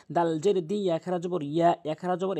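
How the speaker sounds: ordinary talk, nothing marked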